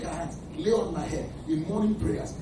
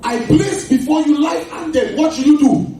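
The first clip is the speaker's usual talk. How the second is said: loud, high preaching cry; full-force preaching; 10 semitones higher, 10 dB louder than usual. Acted. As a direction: thunder the verse at the congregation.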